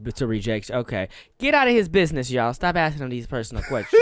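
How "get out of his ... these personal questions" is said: mock scolding. Mock defending guest.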